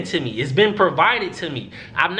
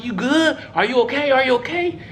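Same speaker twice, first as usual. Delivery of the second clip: higher-pitched imitation of concerned teammates; retold worried check; 6 semitones higher than usual. Teammates asking the injured player, retold concern. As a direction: check on him urgently, voice lifted.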